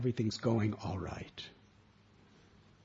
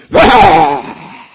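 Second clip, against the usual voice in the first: much louder explosive bah; angry bark; 4 semitones higher, 23 dB louder than usual. Acted. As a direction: snap it out like an angry dog. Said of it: "he goes".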